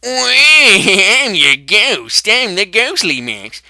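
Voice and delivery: loud, slow, laughing ghost voice; ghostly voice. Acted. The ghost of Max haunting him, loud and slow. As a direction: laugh and drag out the words like a spooky ghost. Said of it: "I don't sound like that".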